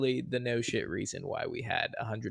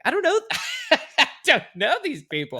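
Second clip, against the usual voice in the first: high-pitched, laughing mock bewilderment; hammed-up discomfort, pitched way up; awkward protest; 4 dB louder, faster than usual. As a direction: squeal it as a comic, uneasy protest. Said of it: "makes me feel weird".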